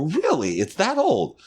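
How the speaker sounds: high, wide-eyed mock surprise; feigned amazement. Man humoring tipsy lady's park history, high mock surprise. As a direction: play along as if hearing news.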